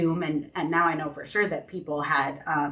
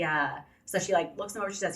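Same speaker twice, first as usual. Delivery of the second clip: ordinary talk, nothing marked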